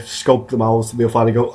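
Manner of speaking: ordinary talk, nothing marked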